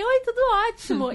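high, bright overeager reply; high, eager greeting; imitating herself. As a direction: squeal it back to the sweet clerk. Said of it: "Aí eu falei".

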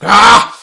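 sudden high monster bark of greed; explosive greedy creature cry; loud creature grab cry. Acted. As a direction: yelp loudly as a beast snatching food. Creature grabs the gem.

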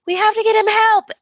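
very high, frantic mock cry; desperate plea. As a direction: plead for help as a panicked heroine. Steffi pleads desperately, retold.

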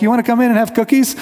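mock neighbor inviting teens in, sweetly; welcoming neighbor. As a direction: play the kind host offering cookies. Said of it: "warm and welcoming".

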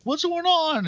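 very high, put-on confused cry; squeaky-high mock confusion; mock bewilderment. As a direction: imitate lost survivors calling out. Acting out wandering crash survivors.

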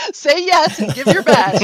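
laughing, slow and loud; laughing loud and drawn out. Real, not acted. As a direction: laugh through a sing-song motto. Laughing joke with hosts.

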